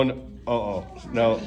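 ordinary delivery, nothing marked

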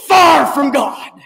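slow, crying-out pulpit delivery; mock hellfire preacher crying out; fiery preaching. Shown as a demonstration. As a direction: preach it like a revival sermon. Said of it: "that's what we like to call a little bit of preaching".

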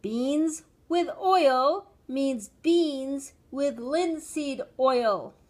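slow, loud, emphatic spelling-out; high, laboured parental patience; exasperated explanation. A narrator's reading. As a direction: explain word by word to a dim child.

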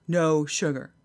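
lightly imitated deadpan trainer; a hint of the matter-of-fact trainer; understated trainer imitation. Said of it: "That's how he talked, like, just like".

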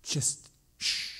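a friend's hushing, voiceless shh; imitated shush. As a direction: hush someone softly without voice. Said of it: "I have friends that go".

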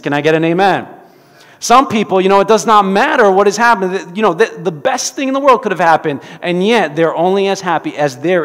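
loud preaching push. Acted. Preacher pressing the congregation, loud. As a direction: drive it home to the church.

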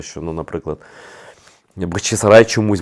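ordinary delivery

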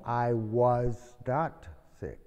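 slow, with "not" stressed and falling; a teacher spelling out a negative answer; slow model answer. Shown as a demonstration. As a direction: drag each word out and punch "not". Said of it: "La respuesta".

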